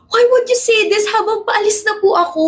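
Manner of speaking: high-pitched aggrieved protest; whining protest. Acted. Child protests to parents on the way out, whining.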